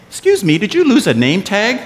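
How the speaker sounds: high-pitched, loud imitation of a questioner; mock polite inquiry. Imitating puzzled people asking him.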